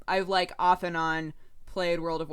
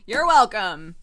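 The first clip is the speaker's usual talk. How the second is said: loud laughing thanks; 12 dB louder, slower than usual. Real, not acted. Repeats thanks after a laugh.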